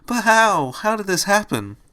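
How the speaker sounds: high-pitched, distressed plea; wailing. A narrator's reading. Fluttershy collapses on the floor, high distressed wail. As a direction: cry out in dismay. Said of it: "Fluttershy wailed".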